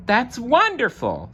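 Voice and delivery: high, bright-spirited exclaim; gaily. Acted. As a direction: cheer with delight. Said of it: "said Moose gaily".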